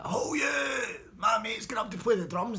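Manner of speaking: high, loud bellowed announcement; bossy shout. Retold or imitated. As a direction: shout the band down. Bully bosses the band.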